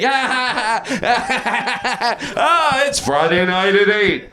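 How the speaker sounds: high staged laughing cries into a slow plug; hammed-up promo antics. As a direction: play wacky for a TV promo. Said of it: "act like you're, like, just doing stuff to me".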